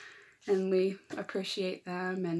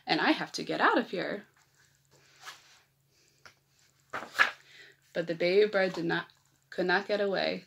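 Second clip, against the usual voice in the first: lively picture-book read-aloud; reading to children. A narrator's reading. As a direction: read it like story time for little kids.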